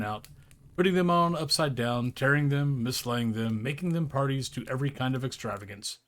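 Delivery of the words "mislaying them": plain reading voice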